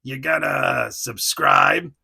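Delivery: put-on shouted Jersey tough-guy accent. Sabretooth tells the folks to subscribe, Jersey accent. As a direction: bark it like a Jersey toy brute. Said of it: "he has, like, a Jersey accent".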